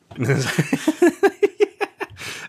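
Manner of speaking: sudden shrieking laugh; loud high laugh. Real, not acted. Laughing at film endings.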